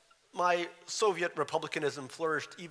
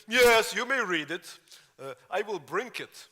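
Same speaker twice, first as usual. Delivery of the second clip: put-on proud immigrant kid, Russian accent; child's thick Russian accent, higher; 8 semitones higher, 9 dB louder than usual. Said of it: "the Russian accent is still thick".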